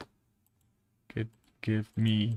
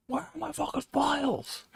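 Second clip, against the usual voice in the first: high squeal. Real, not acted. Frustrated at computer.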